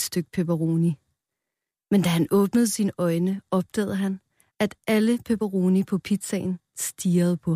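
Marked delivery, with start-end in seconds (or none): none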